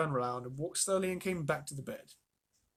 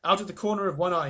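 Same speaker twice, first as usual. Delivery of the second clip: plain reading voice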